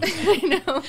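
high laughing I know. Real, not acted. Guest joking about copyright infringement, laughing. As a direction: laugh through the words.

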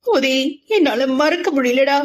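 tearful confession to her friend; starting to sob. A narrator's reading. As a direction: let it crack as she clings to him. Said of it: "தேம்ப ஆரம்பித்தாள்".

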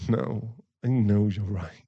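normal talking voice

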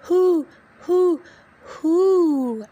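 high, loud hooting; owl hoot. Acted. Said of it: "asked the owl".

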